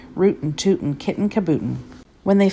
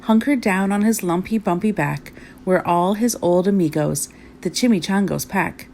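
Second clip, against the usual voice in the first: ordinary delivery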